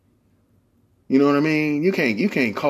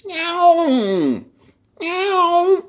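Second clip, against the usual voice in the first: high yowling meows; alley cat yowl; 4 dB louder, much slower than usual. Retold or imitated. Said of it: "I used to hear cats".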